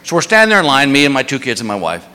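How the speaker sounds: ordinary delivery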